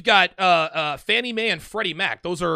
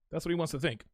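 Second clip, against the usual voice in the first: softer and lower, half to himself; quiet aside; 3 semitones lower, 8 dB quieter, faster than usual. Real, not acted. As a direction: mutter it under your breath. Repeats the line to himself.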